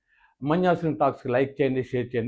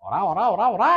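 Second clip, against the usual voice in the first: Sobhan Babu impression; 4 semitones higher than usual. Mimic doing Sobhan Babu's repeated ora, lightly voiced. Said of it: "అని మూడు నాలుగు సార్లు అంటారు".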